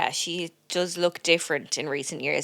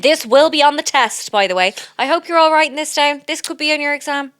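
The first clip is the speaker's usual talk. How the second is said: loud, raised lecturing voice; mock teacher announcement; 8 semitones higher, 8 dB louder than usual. Mock teacher warning students about a test, loud and high. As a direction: announce it to the whole class. Plays a teacher addressing a class.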